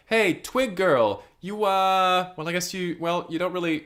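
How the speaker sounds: mock schoolyard heckle fizzling into doubt; faltering taunt. Acted school bully calling out, faltering.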